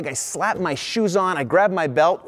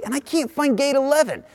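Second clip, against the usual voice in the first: desperate plea; 10 semitones higher than usual. Retold or imitated. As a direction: voice jumps much higher on "gate eleven".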